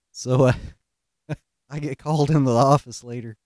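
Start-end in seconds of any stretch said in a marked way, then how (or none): none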